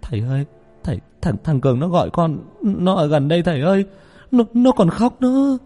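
high, stumbling, distressed plea; frightened stutter rising to a wail; panicked stammer. Acted. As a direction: stammer it out to the teacher, pitch climbing. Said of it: "Tôi lắp bắp".

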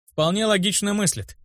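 note it with contented approval; pleased remark. A narrator's reading. Narrator approving of the boy's reasoning, pleased remark. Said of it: "довольно заметил я".

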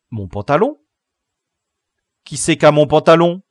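loud rising questions; indignant, high-pitched disbelief; alarmed questioning. A narrator's reading. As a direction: demand it loudly, voice climbing. Boy discovers his trousers are missing.

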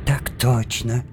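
high, eager yes-sir; deferential subordinate. Acted. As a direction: snap to attention. Subordinate answering boss.

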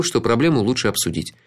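plain reading voice

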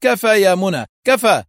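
loud, high call to cease fire; shouting stop. A narrator's reading. Hossam shouting at Mona to stop firing. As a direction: yell at her to stop shooting. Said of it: "وهتف".

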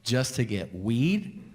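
slow, drawn-out worry; slow inner panic about jinxing himself; slow dread. Retold or imitated. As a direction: spell out the doom slowly. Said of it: "And I'm like".

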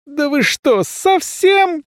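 shouting. A narrator's reading. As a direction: yell it at them in outrage. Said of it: "крикнул я".

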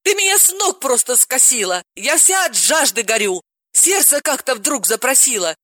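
high, gushing exclamations; excited Galina. Acted. Galina exclaims over the news, high and gushing. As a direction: gush like a giddy friend. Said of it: "воскликнула".

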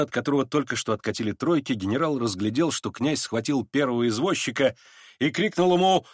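ordinary delivery, nothing marked

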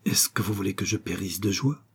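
breathless whisper, overcome with joy; a hushed, trembling old woman's whisper; trembling with emotion. A narrator's reading. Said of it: "d'une voix tremblante d'émotion".

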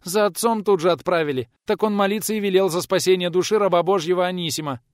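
high, loud village women; peasant women answering. A narrator's reading. Women answer the visitor about the priest, high and insistent. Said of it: "Практически хором отвечали бабы".

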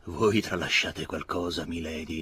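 ordinary delivery

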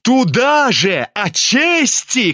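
high, slow spiteful jab; spiteful cry. A narrator's reading. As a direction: cry it out with malice. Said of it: "крикнул он злобно".

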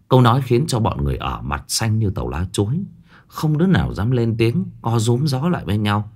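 ordinary delivery, nothing marked

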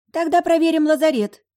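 put-on very high, loud girlish voice; roommate's suggestion. A narrator's reading. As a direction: pipe up loudly with a proposal. Roommate proposes a plan. (очень высокий громкий голос подруги)

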